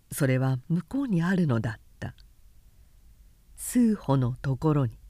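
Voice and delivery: ordinary delivery, nothing marked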